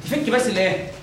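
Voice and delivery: ordinary delivery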